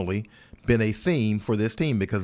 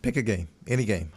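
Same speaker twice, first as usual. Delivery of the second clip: ordinary talk, nothing marked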